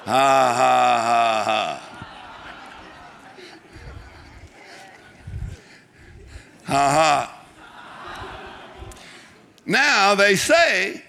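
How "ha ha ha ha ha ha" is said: slow, low, deliberate ha-ha-ha; a put-on laugh, spelled out beat by beat; fake laugh. Shown as a demonstration. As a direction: demonstrate laughing without really laughing. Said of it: "a fake laugh and a real laugh".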